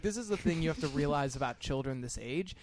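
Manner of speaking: ordinary talk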